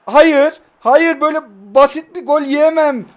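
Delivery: loud, high furious protest; furious yelling after conceding a goal; outraged shout. Real, not acted. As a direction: yell at the cheap goal.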